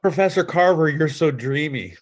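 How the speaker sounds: high, loud swooning gush over the professor; swooning fan. Pretend. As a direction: gush like a lovestruck student.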